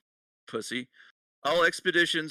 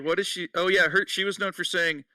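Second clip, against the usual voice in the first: ordinary talk, nothing marked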